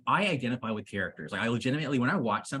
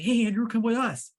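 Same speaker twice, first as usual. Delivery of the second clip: higher-pitched mock friendly call; eager friend's invitation; 10 semitones higher, 4 dB louder than usual. Acting out untrusted friends inviting him along, mock eager call. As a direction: imitate pals calling someone over, pitched up.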